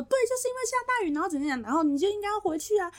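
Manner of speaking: high, fast mock rant; angry boss. Boss ranting on the phone about the flood, high fast mock anger. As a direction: rattle it off like a boss losing it. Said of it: "他就开始大俩公".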